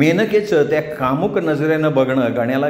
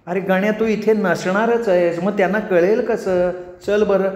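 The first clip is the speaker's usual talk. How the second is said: higher, sweetly impatient nymph; insistent apsara; 6 semitones higher than usual. Acted. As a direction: wave off his worries and urge him along. Menaka urges Ganya along. (आग्रही अप्सरा, चढ्या गोड आवाजात)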